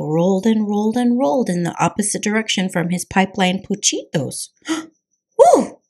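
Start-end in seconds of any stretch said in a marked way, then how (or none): 5.4-5.8 sharp gasp, then high, loud whew; panting gasp and whew. A narrator's reading.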